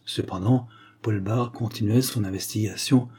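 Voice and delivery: plain reading voice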